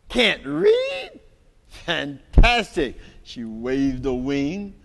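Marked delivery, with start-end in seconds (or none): 0.1-3.0 high squeal of delight; the bird thrilled to have a pupil; delighted surprise. Acted.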